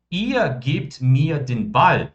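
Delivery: very slow, every German word laid out clearly; slow model sentence. Shown as a demonstration. Teacher modelling German for students, very slow and clear. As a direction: spell the sentence out slowly for the class. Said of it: "quando eu falo".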